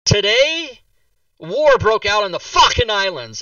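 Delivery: loud, high, drawn-out proclamation; dramatic announcement. Acted. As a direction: announce it like a comedy bit. Acting dad's door-flinging pun announcement.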